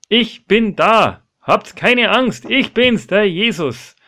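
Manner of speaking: high, slow, far-carrying call. Acted. Jesus calls to the frightened men over the waves, loud drawn-out call. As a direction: call reassurance across the water, stretching words. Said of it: "Es ruft ihnen zu".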